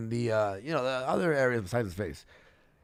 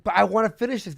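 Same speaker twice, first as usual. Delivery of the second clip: mock whiny insistence, higher and louder; insistent protest; 7 semitones higher, 6 dB louder, faster than usual. Boyfriend arguing with girlfriend on stream, mock insistent. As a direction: imitate someone pleading to be allowed to continue.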